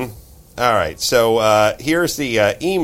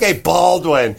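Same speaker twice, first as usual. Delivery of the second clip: higher mock-indignant heckle; mock complaint; 6 semitones higher, 4 dB louder, slower than usual. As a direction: play at being rudely ungrateful. Acting out a rude imagined reply.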